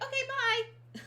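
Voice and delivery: very high, loud imitation of a child; mock-excited high-pitched farewell; excited goodbye. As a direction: chirp a quick goodbye like a kid. Acting out child's abrupt goodbye.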